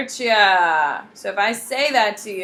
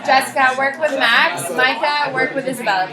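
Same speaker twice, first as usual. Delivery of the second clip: calling across the room; 6 semitones higher, 6 dB louder than usual. Real, not acted. Teacher calling across classroom.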